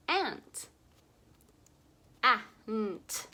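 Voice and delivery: "ant" with short a, stretched; short A sound. Shown as a demonstration. Teacher names the picture for kids, sounding it out. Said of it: "This is an".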